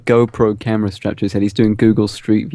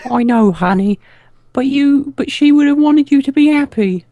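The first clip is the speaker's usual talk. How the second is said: put-on high child mayor voice; 13 semitones higher, 5 dB louder, slower than usual.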